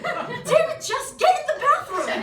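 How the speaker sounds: high, loud laughing yell; half-laughing shout to get in the bathroom; exasperated yell. Retold or imitated. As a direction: yell at the kid at the straw counter. Yelling at puking girl.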